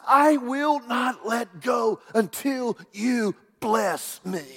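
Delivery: slow, high, emphatic vow; Jacob's refusal. Retold or imitated. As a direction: refuse word by word, holding on. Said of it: "and Jacob says".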